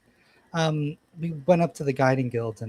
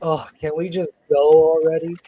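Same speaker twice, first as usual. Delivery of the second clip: put-on louder, put-upon teenage whine; bored teen whine; 3 semitones higher, 8 dB louder than usual. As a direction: sigh it out like a fed-up teenager. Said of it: "He's just like".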